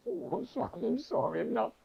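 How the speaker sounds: high, sheepish little plea; a caught-out boy squeaking sorry; imitating his younger self apologizing to Swami; flustered apology.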